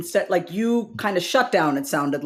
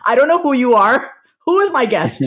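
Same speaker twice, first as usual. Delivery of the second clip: put-on mom's thick Indian accent, a bit louder; 3 semitones higher, 4 dB louder than usual. Mom on the phone to a stranger, thick Indian accent. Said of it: "speak English in a very thick Indian accent".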